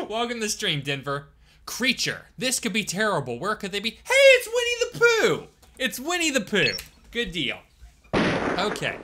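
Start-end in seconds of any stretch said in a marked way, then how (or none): 3.9-5.5 high, loud, slow thrilled cry; excited shout. Acted. Playing the game character, spotting Winnie the Pooh, thrilled shout. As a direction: call out the name like a delighted kid.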